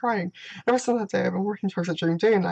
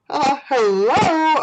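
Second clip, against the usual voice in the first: calling out; 6 semitones higher, 6 dB louder, much slower than usual. Real, not acted. Calls out to get attention.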